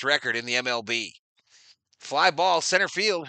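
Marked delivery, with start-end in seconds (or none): 2.0-3.3 excited play call. Real, not acted.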